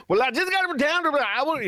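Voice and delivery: fast, high, slurred singer imitation with a laugh. Host mocks [name]'s garbled lyric, fast and high. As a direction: rush and slur it like a mumbling rock singer, pitched high. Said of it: "But he's like".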